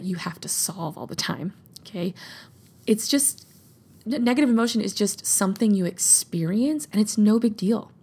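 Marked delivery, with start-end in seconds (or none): none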